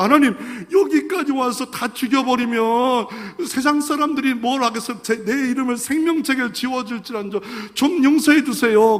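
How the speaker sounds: high, urgent plea; earnest desperate intercession; Moses pleading. Acted. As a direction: beg God to spare the people.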